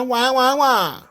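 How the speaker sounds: high, whiny wah wah wah; mock crying wah. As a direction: imitate a crybaby. Mocking new catchphrase.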